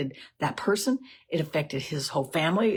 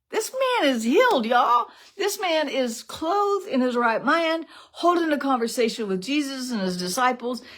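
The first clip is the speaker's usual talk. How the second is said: excited exclaim; 6 semitones higher than usual. Real, not acted. Preacher marvels to the congregation, voice leaping high. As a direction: proclaim the healing with a thrill.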